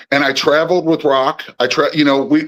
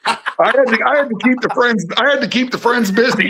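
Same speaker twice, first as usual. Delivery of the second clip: laughing; 9 semitones higher than usual. Real, not acted. Laughing through the joke.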